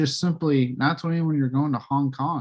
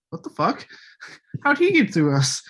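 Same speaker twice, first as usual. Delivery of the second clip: mock disbelief, climbing higher; lightly acted, higher-pitched bafflement; baffled cops; 5 semitones higher than usual. Imitating cops as Batman walks out, baffled. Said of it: "I love the cops' reaction".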